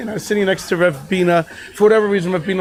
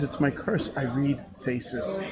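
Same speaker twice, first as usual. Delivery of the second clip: much softer, low, slow murmur; quiet confession; 6 semitones lower, 13 dB quieter, slower than usual. Retold or imitated. The rabbi confiding his gift to the teacher, much softer and slow. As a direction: hush it like a secret burden. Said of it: "he says".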